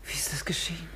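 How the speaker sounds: a woman whispering after hearing of a friend's murder. Acted. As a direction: breathe the question in shock. Shocked woman whispers about murder.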